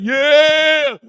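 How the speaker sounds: loud, high retold shout; celebrating a made shot; mock team cheer. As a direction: whoop like a team after a basket.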